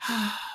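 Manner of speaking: soft acted sigh; parent's sigh. Parent with no time for sadness, acted sigh. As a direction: let out a quiet sigh. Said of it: "has some empathy and sympathy".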